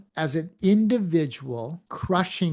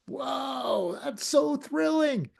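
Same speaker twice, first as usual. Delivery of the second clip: higher, shouted mock thrill; mock excitement; 9 semitones higher, slower than usual. Speaker acts out an excited listener hearing the word change, shouting. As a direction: play a thrilled voice, pitched up and bright. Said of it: "Is it excitement?".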